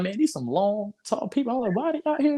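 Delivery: high, confused thinking aloud on a dark beach; puzzled high voice. Retold or imitated.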